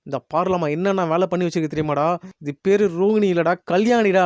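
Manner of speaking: plain reading voice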